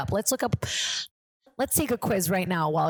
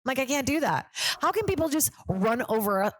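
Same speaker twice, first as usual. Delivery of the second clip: ordinary talk, nothing marked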